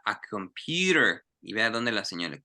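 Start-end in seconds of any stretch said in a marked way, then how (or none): 0.0-1.2 "A computer" said slowly, every letter; slow it down, show each letter. Shown as a demonstration. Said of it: "vea cada letra".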